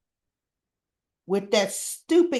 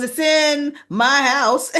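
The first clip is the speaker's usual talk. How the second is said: slow, louder retort; hammed-up defiant reply; mock angry retort; 4 semitones higher, 6 dB louder, slower than usual. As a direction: hit back slowly and firmly. [name] arguing with Drew.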